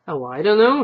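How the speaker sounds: a nettled wife snapping back. Acted. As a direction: bristle at the remark. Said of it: "was nettled".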